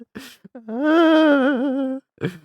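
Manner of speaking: big loud laugh; loud high laugh. Real, not acted. Streamer laughing loudly while signing off.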